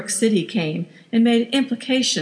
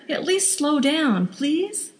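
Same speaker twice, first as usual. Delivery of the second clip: put-on high, slow childlike pleading; pleading like a young girl; 8 semitones higher, slower than usual. Wife pleading with her husband like a young girl. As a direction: plead like a little girl wanting only the best. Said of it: "Trish was pleading like a young girl".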